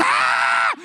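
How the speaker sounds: long, strained mock scream; imitated scream. Man shaking under the power, imitated long scream. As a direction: yell out like the man vibrating on the floor. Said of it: "And he says".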